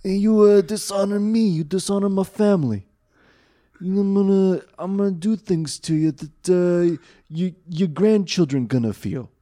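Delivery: mob boss. Retold or imitated. Telling Kaiba's threat to the bully, as a mock mob boss. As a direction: lean in like a mafia don. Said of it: "Like a fucking mob boss".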